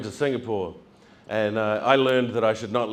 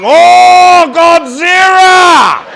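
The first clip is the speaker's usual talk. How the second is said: high, loud, drawn-out scream of Godzilla; terrified shout; 18 dB louder, much slower than usual. Retold or imitated. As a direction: shriek like tiny villagers seeing a monster. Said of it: "staring up going".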